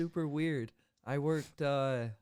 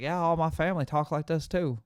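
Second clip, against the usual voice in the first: loud, raised Southern imitation; mock deep Southern accent; 4 semitones higher, 8 dB louder than usual. Imitating the contact defending his family's speech, mock Southern accent. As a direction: lay on a put-on Southern accent. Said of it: "he talked with a deep Southern accent".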